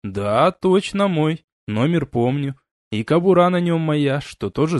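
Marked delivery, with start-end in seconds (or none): none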